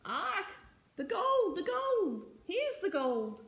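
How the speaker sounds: very high, excited parrot squawks; screeching parrot. A narrator's reading. Green parrot announces the gold, screeching. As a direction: squawk it out high in short bursts. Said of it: "screeched the green parrot".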